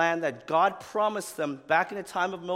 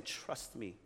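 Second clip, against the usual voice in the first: soft reassurance; 5 semitones lower, 9 dB quieter, slower than usual. Retold or imitated.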